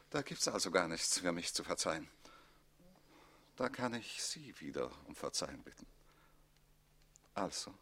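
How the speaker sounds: abashed quiet apology; sheepish. Acted. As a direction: back down in embarrassment. Count embarrassed, deflated.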